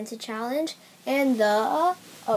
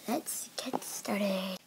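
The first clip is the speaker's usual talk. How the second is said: whisper; 3 semitones lower, 6 dB quieter, much slower than usual. Real, not acted. Whispered intro to the challenge.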